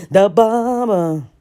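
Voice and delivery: The bob announced with a flourish; showy announcement; proud reveal. Shown as a demonstration. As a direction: say The bob like unveiling a prize.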